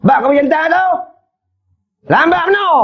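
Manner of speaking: hammed-up angry husband. Shown as a demonstration. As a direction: bark it at the wife, impatient. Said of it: "tinggi suara dengan bini".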